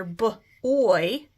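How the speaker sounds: short "b", then a separate "oy"; sounded out. Shown as a demonstration. Teacher showing where she hears oy in boy, sounded out. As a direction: split "b" from "oy" as isolated sounds. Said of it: "where I hear".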